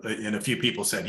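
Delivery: ordinary delivery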